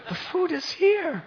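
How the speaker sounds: put-on a boy begging through tears; crying child pleading. Boy insists to Gopinath the food is still there, tearful and high. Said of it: "again he started crying".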